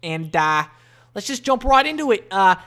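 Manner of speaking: loud, hammed-up Aussie podcast host; brash, amateur Australian accent. Shown as a demonstration. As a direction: open with a big Australian "Oi". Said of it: "I can do an Australian accent pretty good".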